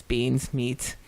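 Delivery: ordinary talk, nothing marked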